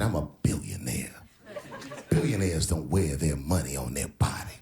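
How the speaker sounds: very low, hushed boast. Retold or imitated. Comedian imitating a rapper boasting on a podcast, low and hushed. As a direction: drop deep and murmur it like a rapper. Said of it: "He said".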